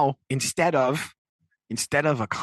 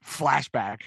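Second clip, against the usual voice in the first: normal talking voice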